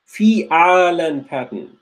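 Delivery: slow, every syllable of Fi'alan drawn out; slow and deliberate. Shown as a demonstration. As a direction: spell out Fi'alan slowly for the students. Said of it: "don't, don't, don't have to be fast".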